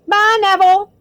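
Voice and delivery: high, loud farewell call. A narrator's reading. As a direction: holler a cheery goodbye across the room. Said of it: "called Stan".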